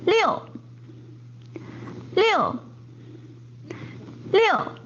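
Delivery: 六 falling sharply from high; 六 steep falling tone; fourth tone liu. Shown as a demonstration. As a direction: snap 六 down, fourth tone. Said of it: "六 is fourth tone".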